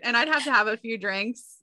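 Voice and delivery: ordinary talk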